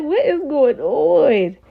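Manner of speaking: high, drawn-out incredulous cry; exasperated bewilderment. Retold or imitated. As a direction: stretch every word in disbelief. Host recalls reaction to the bickering.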